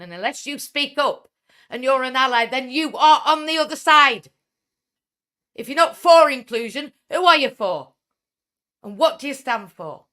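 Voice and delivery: high, full-force challenge; impassioned shouting. Real, not acted. Speaker challenges those not for inclusion, impassioned shouting. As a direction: shout it as a fierce rallying demand.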